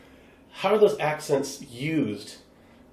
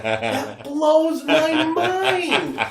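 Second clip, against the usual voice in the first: high, drawn-out amazed shout; amazed cry with every word stretched; mind-blown exclamation; much slower than usual. Retold or imitated. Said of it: "And I'm like".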